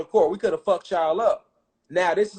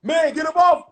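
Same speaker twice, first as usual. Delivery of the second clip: shouted order; 8 semitones higher, 3 dB louder than usual. Retold or imitated. Peacemakers pulling them apart.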